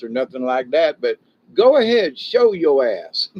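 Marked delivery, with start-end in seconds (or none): 1.5-3.3 loud, higher taunt, the last words slowed; taunting dare. Pretend. Daring an intruder on the driveway, loud mocking taunt. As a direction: egg him on to try it. Daring a trespasser to start trouble.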